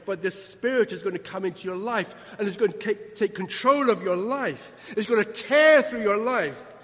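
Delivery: building to a loud, forceful sermon peak; impassioned preaching. Real, not acted. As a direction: drive each phrase home from the pulpit. Preaches the Spirit's power.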